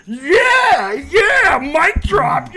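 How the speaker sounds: hammed-up gleeful cheering, high-pitched; Kimberly Martin. Host mocking TV pundit celebrating the coach's speech, gushing triumph. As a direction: crow it high like a mock victory. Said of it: "cut to Kimberly Martin".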